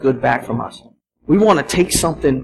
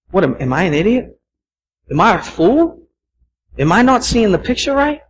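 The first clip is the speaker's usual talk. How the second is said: self-doubting; 5 semitones higher than usual. Retold or imitated. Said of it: "You start to question yourself".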